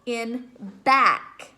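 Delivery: ordinary talk, nothing marked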